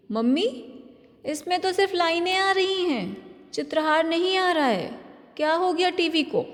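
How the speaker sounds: put-on high, whiny little girl; high childlike complaint about the TV; child voice. A narrator's reading. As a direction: play the disappointed young daughter.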